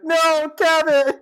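high, drawn-out cry of No, Kevin; pleading cry. Retold or imitated. Rod betrayed when Kevin hits him too, high slow cry. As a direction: wail it slowly in betrayed disbelief. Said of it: "he's like".

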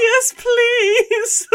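high, loud, half-crying plea; tearful relief. Retold or imitated. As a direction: beg it through tears and a laugh. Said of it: "I was like".

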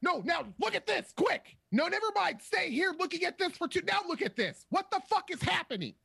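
hammed-up panic, spiralling out of control; frantic exasperation. Mocking the camera work's constant cuts, frantic and exasperated.

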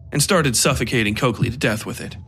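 plain reading voice

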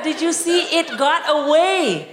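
ordinary talk, nothing marked

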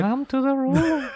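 high, slow female spirit call; eerie beckoning voice. Acted. Friend butts in as the dream voice, high slow eerie call. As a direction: lure them in, high and drawn out. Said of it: "I'm listening to the voice".

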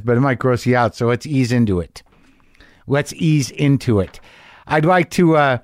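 ordinary talk, nothing marked

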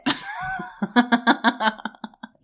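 shrieking laugh, very high; real high-pitched cackle at the quote; high squealing laugh. Real, not acted. Cracking up at the quote.

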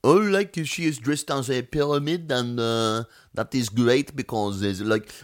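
high, breezy Alsace French accent, pretending to know fashion; a French driver at Fashion Week in a put-on Alsace accent. Esteban faking expertise at Fashion Week, Alsace French accent. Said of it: "given him an Alsace French accent".

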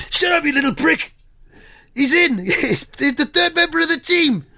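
high, indignant royal outburst; King Charles impression. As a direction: pull rank, high and huffy. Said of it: "proper impression".

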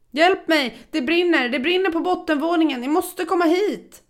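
high, loud, breathless fire alarm cry; panicked call for help. Acted. Narrator calling the emergency number, high panicked shouting. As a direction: scream down the phone that it's burning.

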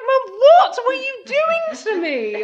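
high, loud, laughing protest; laughing mock complaint. Laughing as she protests to her mum about the outfit. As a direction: wail at mum through giggles.

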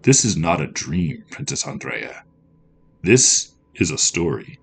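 measured voice fading to a whisper; trickster spirit, ominous. A narrator's reading. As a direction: let it sink down to a hushed end.

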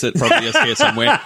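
quick, high, laughing tease; giggling teasing remark. Real, not acted. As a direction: laugh through the line. Teasing about junk.

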